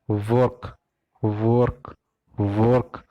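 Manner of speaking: वर्क with a rounded vowel and sounded r; वर्क, every letter audible including r; work demonstration. Shown as a demonstration. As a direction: say वर्क as "vork", slowly. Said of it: "फिर बीच में आएगा ना र".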